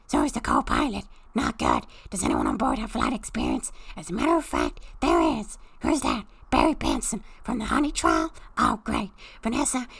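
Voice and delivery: usual speaking voice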